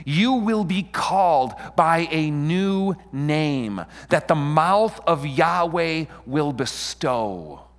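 slow, high, ringing declaration; scripture proclamation. Acted. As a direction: proclaim each phrase grandly, drawn out.

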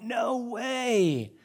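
a hammed-up, high emphatic refusal. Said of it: "a really emphatic".

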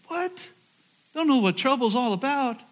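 half-laughing disbelief at being told he knows no trouble; incredulous what. Retold or imitated. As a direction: throw the phrase back, stunned by it. Indignant retort to roommate.

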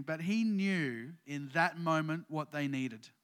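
ordinary talk, nothing marked

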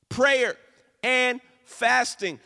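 Prayer and fasting, slow and weighty; stressing Prayer and fasting; slow emphatic restatement. Shown as a demonstration. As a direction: hit each word, slowly. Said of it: "restated emphasis".

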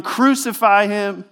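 half-hearted imitation of an angry crowd; mock crowd yell. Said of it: "they're yelling".

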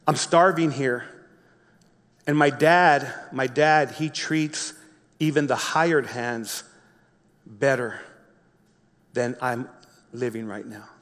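a starving son's hopeless murmur; despairing inner voice. Retold or imitated. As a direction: mutter it to yourself, broken down.

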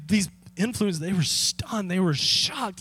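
normal talking voice

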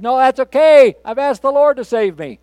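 high, loud stubborn no; stranded man yelling that he is fine; shouted refusal. Retold or imitated. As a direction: shout back up at the helicopter.

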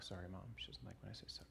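voiceless whisper to the crowd; whispered aside. Real, not acted. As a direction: drop to a confiding whisper. Aside about his mom.

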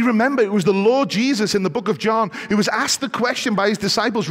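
high, loud, fervent sermon delivery; impassioned pulpit voice building to a peak; full-force preaching. Acted. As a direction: drive it home like a revival preacher.

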